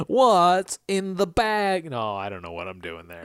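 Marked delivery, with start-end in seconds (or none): 0.1-1.9 very slow, drawn-out odd voice; a weird made-up thug voice; odd put-on voice. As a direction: stretch each word strangely. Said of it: "I don't know what that voice is".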